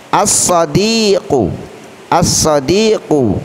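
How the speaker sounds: slow "As-sadiqu", doubled s and long i; al not read. Shown as a demonstration. Teacher models the example twice, "As-sadiqu" without l. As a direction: hold the s and stretch di in "As-sadiqu". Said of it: "Alif lamnya tidak boleh kita baca dengan jelas".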